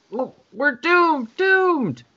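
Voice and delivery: high, slow despairing wail; panicked wail. Acted. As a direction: wail it like all is lost. Panicked council voices.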